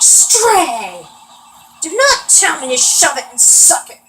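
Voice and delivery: a hint of an angry stepdad scolding; stern stepdad. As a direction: snap at the kid like a fed-up stepdad. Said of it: "he's like".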